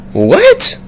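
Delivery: very high rising squeak; acted bafflement. Vlogger replaying his stunned reaction, baffled. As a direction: flip up high in disbelief.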